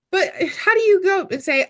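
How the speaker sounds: normal talking voice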